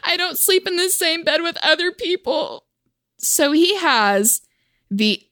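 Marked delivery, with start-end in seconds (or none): 0.0-2.7 a lightly raised put-on voice; mock indignant girl. To the man, feigning innocent offense. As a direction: act offended and naive. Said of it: "So I say".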